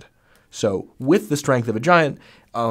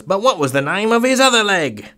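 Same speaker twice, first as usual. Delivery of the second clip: high, loud bad Cockney accent; hammed-up Cockney straight man; 9 semitones higher, 5 dB louder than usual. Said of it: "Sorry for the terrible Cockney accent".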